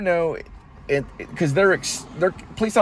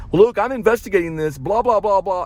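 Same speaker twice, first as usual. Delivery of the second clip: lightly mocking officer, higher pitch; mimicking an officer; 8 semitones higher, 3 dB louder than usual. Jogger retelling the officer's questions, mildly mocking imitation.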